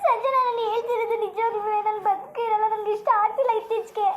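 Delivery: high wailing plea broken by sobs; crying. Acted. Heartbroken woman begging her friend not to scold, crying. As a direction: cry out your hurt between sobs. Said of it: "ಕಣ್ಣೀರು ಒರೆಸು ನೀನು".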